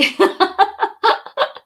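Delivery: high airy laugh with no voice; breathy laugh. Real, not acted. Joking with Yati about buyers, airy laughter.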